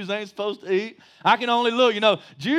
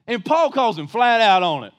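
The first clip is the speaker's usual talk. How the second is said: ordinary talk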